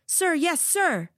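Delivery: high barked sir yes sir; barked military recruit reply. A narrator's reading. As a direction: bark it back like a recruit. Recruits answer in chorus.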